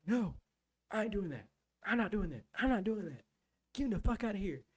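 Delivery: high-pitched, insistent refusal; imitated defiant refusal. Recruit refusing the instructors' orders, defiant.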